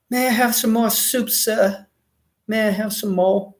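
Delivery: lightly hammed-up humble beggar asking the master; begging serf. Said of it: "we're gonna be the serfs going up, say".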